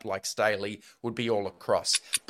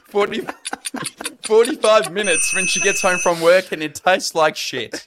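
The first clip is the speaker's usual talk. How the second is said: higher, louder, breaking into laughter; laughing rant; 6 semitones higher, 6 dB louder than usual. Real, not acted. Guest roasting his mum's cake on the show, laughing as he rants. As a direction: crack up while ranting.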